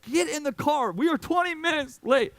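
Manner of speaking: high, loud sister throwing a fit; mock fit-throwing sister. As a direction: imitate a sister nagging to get in the car. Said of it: "she's, like, throwing a fit".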